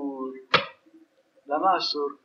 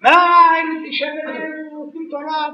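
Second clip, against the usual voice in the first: angels crying out; 10 semitones higher than usual. Retold or imitated. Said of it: "המלאכים התחילו לצעוק".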